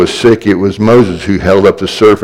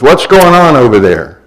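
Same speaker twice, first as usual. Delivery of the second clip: raised, higher voice of a mother; exasperated mom checking on the kids; mom calling out; 5 semitones higher, 3 dB louder than usual. Acted. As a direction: call to the kids in the other room. Mom calling from another room.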